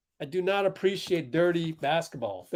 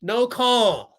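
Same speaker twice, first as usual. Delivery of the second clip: loud, raised protest; real outrage, louder and higher; indignant outburst; 6 semitones higher, 5 dB louder than usual. Real, not acted. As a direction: yell it at the referee in disbelief. Indignant at uncalled foul live.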